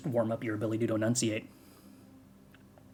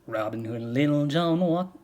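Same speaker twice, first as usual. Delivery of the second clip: country twang. Shown as a demonstration. Streamer trying the song in a country twang. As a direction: give it a Southern country twang. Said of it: "put a bunch of country twang on this".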